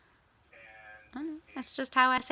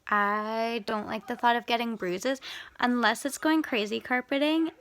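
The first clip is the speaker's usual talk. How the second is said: ordinary delivery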